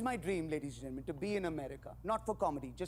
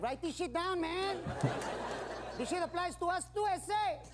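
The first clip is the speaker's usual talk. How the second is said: higher, lightly voiced Mexican accent; mock Mexican guys; 9 semitones higher than usual. Comic voicing two Mexicans in the back, higher mock Mexican accent. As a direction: play two guys in the back who take notes. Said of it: "two Mexicans in the back, like".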